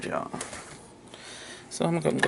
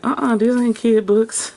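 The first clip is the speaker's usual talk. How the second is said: higher, louder, drawn-out mock protest; indignant objection; 5 semitones higher, 5 dB louder than usual. Retelling her reaction to donated books, mock indignation. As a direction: object firmly, retelling your own reaction.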